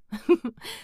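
short light laugh; amused chuckle. Real, not acted. Reader after acting the aunt, chuckling. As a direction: chuckle at your own overacting.

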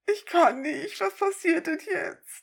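high, giggling can't-believe-it; laughing disbelief. Real, not acted. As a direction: squeak it through laughter. (lachend ungläubig)

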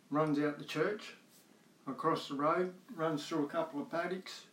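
ordinary talk

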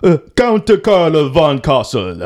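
drawn-out hammed-up German accent. Shown as a demonstration. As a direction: ham up a German count's accent. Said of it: "let me see if I can do this".

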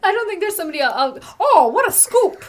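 put-on high, loud old-timey paperboy; playful 1920s newsboy hype; newsie voice. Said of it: "newsies from the twenties".

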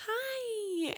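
high, drawn-out "hi"; squeaky sing-song hello; cooing greeting. Retold or imitated. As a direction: greet a horse in a sweet high coo. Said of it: "and I was like".

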